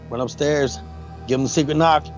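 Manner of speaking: normal talking voice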